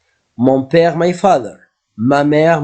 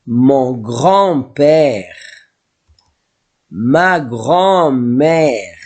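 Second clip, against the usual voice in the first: slow model words; much slower than usual. Shown as a demonstration. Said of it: "modelling family words".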